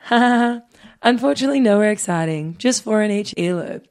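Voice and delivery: imitating her friend. Host reads co-host's own reply back to her, imitating her. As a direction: rattle off the friend's reply with a spelt-out ha ha. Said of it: "I'm doing you in like, ha ha".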